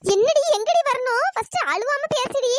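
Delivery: slow, high, impatient demand; exasperated scolding. Acted. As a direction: scold her to talk sense. Scolds crying girl to explain. (எரிச்சலோட அதட்டுறது)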